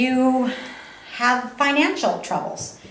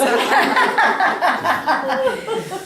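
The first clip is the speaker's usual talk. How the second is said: laughing; 9 semitones higher than usual. Real, not acted. Laughing at the joke.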